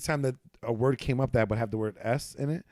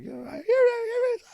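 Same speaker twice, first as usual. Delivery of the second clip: put-on high excited girlfriend voice. Girlfriend voiced catching lisp.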